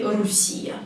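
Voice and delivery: "Russia" as ru-SSI-ya, the learners' error; wrong U vowel. Shown as a demonstration. Teacher pleading against a common mistake, "Russia" said ru-SSI-ya. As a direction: swap in a U at the start of "Russia", stress "si". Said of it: "Please don't say Russia".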